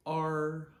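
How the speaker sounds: R as the letter's name, high and level; first tone. Shown as a demonstration. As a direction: hold R loud on one high note. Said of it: "the name of the letter R".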